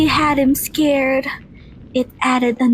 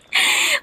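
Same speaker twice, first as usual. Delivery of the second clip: giggle; 13 semitones higher than usual. Acted. Girl laughs at the silly idea.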